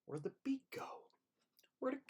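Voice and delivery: breathy whisper to himself. Real, not acted. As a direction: whisper it, puzzled the music quit. Music suddenly stops.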